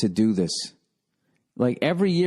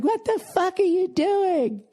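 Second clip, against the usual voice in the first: much higher, slow, outraged woman's shriek; imitating shrill woman; slower than usual. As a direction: mimic a frantic shopper clinging to her TV. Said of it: "She's going like".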